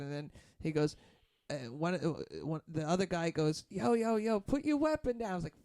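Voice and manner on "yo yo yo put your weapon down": high-pitched imitation of a street guy; mock urgent warning. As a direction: call out as if heading off a fight. Retold street guy urging weapon down.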